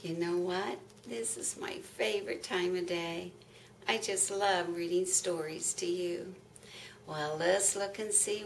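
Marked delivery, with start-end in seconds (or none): none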